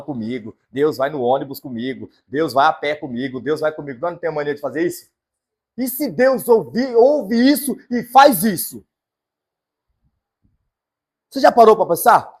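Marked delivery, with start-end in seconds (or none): 5.8-12.3 higher, louder, slow rhetorical question; preacher confronting the congregation; loud rhetorical challenge. Real, not acted. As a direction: drive the question home to the crowd.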